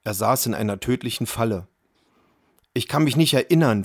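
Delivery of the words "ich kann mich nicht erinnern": ordinary delivery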